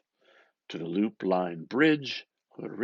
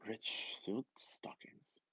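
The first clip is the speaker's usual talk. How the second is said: whisper; 8 dB quieter, slower than usual. Acted. Bloom's whispered desire.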